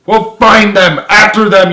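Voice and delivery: high, loud furious order; angry yell. A narrator's reading. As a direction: bark it at the soldiers. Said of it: "yelled Henderson".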